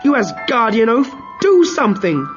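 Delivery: furious shout. Acted. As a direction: berate your husband at the top of your voice. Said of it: "shouted at her husband".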